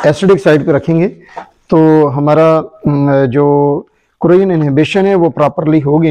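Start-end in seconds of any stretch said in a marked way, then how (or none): none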